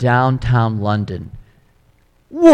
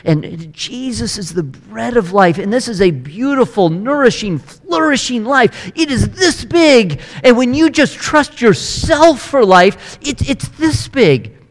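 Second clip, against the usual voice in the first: rising excitement; 9 semitones higher than usual. Acted. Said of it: "And Almighty God is saying".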